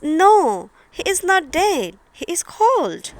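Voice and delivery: put-on a touch of a girl insisting; Thumbelina's voice. Thumbelina telling the mole the bird lives, in a light girlish voice.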